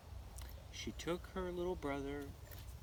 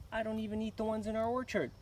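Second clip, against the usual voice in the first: put-on snooty girl; 7 semitones higher, 3 dB louder, faster than usual. A narrator's reading. Girl scoffs at offer.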